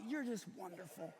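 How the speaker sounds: soft, hushed endearment; acted tenderness. Dying husband to his wife, acted tenderness. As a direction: drop to a soft, loving murmur.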